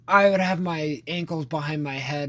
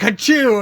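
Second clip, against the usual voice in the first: mock sneeze; 5 semitones higher, 8 dB louder than usual. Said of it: "He's like".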